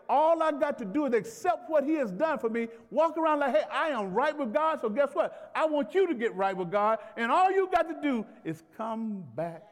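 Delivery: driving sermon delivery that keeps building; emphatic preaching. Real, not acted. Preacher calls church to come back to God, emphatic preaching. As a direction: declare it with rising conviction.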